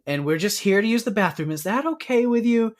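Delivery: high, careful, rising to a question; tentative politeness. Retold or imitated. Retold Elliot nervously talking to a plant, tentative politeness. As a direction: politely ask permission, pitch climbing.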